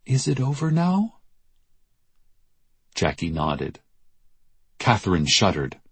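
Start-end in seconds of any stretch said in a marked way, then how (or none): 0.0-1.2 timid, higher-pitched question; small, shaken voice. A narrator's reading. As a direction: ask it small and high, needing reassurance. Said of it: "asked in a small, shaken voice".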